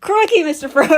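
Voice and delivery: high, loud Aussie hobbit, full of laughter; Australian Sam. Pretend. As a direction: call out in a hammed-up Australian accent. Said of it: "Australian Sam".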